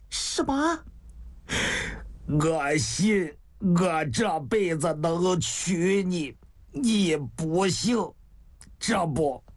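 slow, labored words with long pauses; weakening, dying. Acted. Dying man conceding to his beloved, slow and weak. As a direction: struggle to get each phrase out. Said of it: "王向清的声音是越来越微弱呀".

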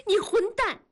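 high, loud angry cry; furious curse. A narrator's reading. Meimei curses Dazhuang to his face, furious shout. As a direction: spit the insult out in fury. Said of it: "梅梅气愤地骂".

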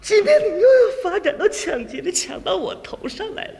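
angry wife. Acted. Wife berates gambling husband, angry. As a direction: berate him as an outraged wife. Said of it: "爱人跟我就火了".

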